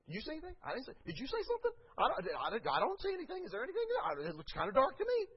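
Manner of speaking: mock innocent, high and quick; playing stupid. Believer pretending to God not to see, mock innocent. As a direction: feign cluelessness, voice up high. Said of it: "turn my head and go".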